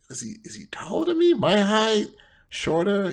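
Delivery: quick, high-pitched retold questions; retold guessing. Friend guessing his pal's height before meeting, high-pitched wondering. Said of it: "I'm like, like".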